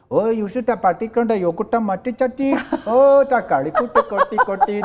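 loud, high mock Indian gibberish; hammed-up Indian customer babbling in Hindi; Hintçe taklidi. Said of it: "benimle Hintçe konuşuyordu". (abartılı Hintli taklidi)

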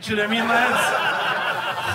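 loud, drawn-out mock English accent; hammed-up English lad accent. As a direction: heckle like a caricature English lad. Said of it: "the accent is an English accent".

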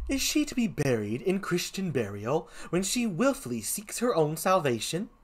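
lilting comic gravedigger; put-on Shakespearean clown; first clown voice. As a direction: question it as a swaying, sing-song clown. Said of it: "So here's the first clown".

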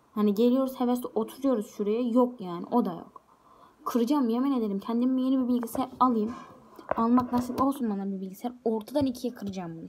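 loud exasperated ranting; frustrated rant. Real, not acted. To her viewers about the broken laptop, a loud fed-up rant.

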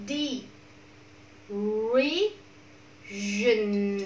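derision split as "D", then "Region", long pauses; syllable by syllable. Shown as a demonstration. Teacher breaks word into syllables.

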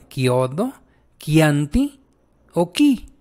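"kiodo, kianti o ki" each with hard k; hard K sound. Shown as a demonstration. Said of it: "la vamos a pronunciar como la K".

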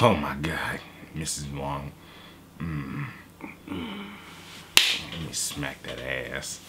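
low, soft mock-smitten murmur; hammed-up quiet come-on; hushed lusting suitor. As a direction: play the smitten admirer, low and slow. Acting himself wooing the woman.

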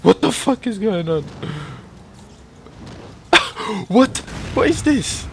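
high, loud baffled exclamations; baffled outcry. Real, not acted. Gamer baffled by a weird glitch, high and loud. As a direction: react in disbelief to the glitch.

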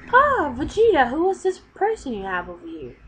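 bright hostess calling across the room; high Bulma voice. Acted. Bulma greeting Vegeta's unexpected guest, high and loud.

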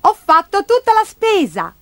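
put-on high, loud child voice; high-pitched, excited boy character; boy exclaiming. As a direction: announce it proudly as a small boy. Boy proudly announces to grandma.

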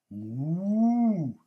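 drawn-out mooing; cow moo. A narrator's reading. Cow answering duck with a long moo. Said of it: "said the cow".

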